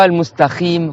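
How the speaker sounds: al-mustakhim, qaf turned to a rasped kh; wrong kh in al-mustaqim. Shown as a demonstration. Teacher shows how some mispronounce it, demonstrating kh for qaf. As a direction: kh replacing q in mustaqim. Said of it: "comme certains disent".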